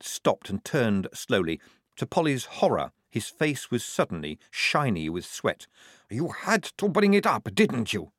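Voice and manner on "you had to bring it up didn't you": lightly hoarse, higher-pitched reproach. A narrator's reading. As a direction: accuse them in a strained, hoarse voice. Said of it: "he said hoarsely".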